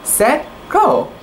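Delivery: lightly voiced, rising start call; excited shout. A narrator's reading. Girl starting a game of tag, calling out the start. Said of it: "shouted Sedwa".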